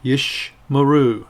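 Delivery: "Yish Maru" slow, with a gap after Yish; pronounced for repetition. Shown as a demonstration. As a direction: model "Yish Maru" slowly, falling at the end. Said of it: "pronounce them after me".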